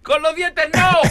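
high comic shriek of protest; pained Tarzan cry. Acted. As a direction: yell in mock pain at the chimp. Playing Tarzan in pain. (grito agudo de dolor fingido)

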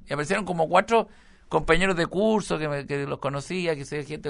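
normal talking voice

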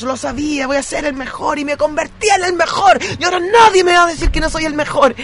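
rising to a triumphant shout; messianic shouting speech. Retold or imitated. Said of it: "es como un mesías total". (discurso mesiánico que sube hasta el grito)

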